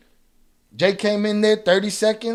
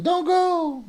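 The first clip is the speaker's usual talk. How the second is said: high fake whine; mock pleading; 9 semitones higher, 3 dB quieter than usual. Streamer mocks a troll he banned, high pleading whine.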